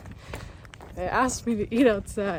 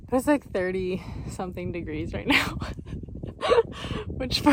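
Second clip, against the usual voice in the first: ordinary talk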